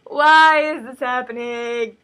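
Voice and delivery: slow, hammed-up tearful whine; distraught wail. Tessa protests to Miranda, slow tearful wail. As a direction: wail it in mock despair. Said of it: "throws her for a loop".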